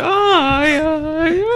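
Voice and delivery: high falsetto. Pretend. Mock voice in parody song.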